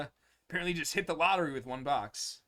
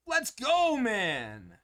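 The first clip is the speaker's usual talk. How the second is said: excited; 5 semitones higher, 4 dB louder, much slower than usual. Real, not acted. Celebrating pull.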